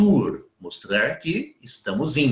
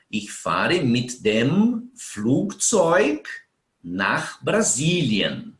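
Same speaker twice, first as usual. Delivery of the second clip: slow model sentence; much slower than usual. Shown as a demonstration. Teacher models German sentence.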